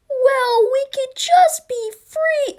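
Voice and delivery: very high, slow squeaky little voice; timid suggestion. Acted. California sees America getting red, timid suggestion. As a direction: offer it in a comically tiny high voice.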